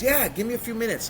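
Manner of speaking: high, loud buddy assurance; imitated eager friend. Friend promises to come soon, excited. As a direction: put on a hyped phone voice.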